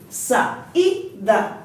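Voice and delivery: "saída" spaced out as sa, í, da; syllable by syllable. Shown as a demonstration. Teacher showing why the I takes an accent, syllable by syllable. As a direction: leave the í of "saída" standing alone. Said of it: "o I está sozinho".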